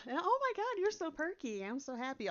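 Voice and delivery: hammed-up over-cheerful gasp; mock perky gush. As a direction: overdo the bubbly enthusiasm to mock it. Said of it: "that's exhausting".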